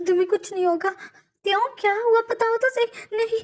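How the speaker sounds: tearful, disbelieving questions rising to a cry; wife learning her husband is dying, crying; crying in shock. Acted. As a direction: sob out his name as it sinks in.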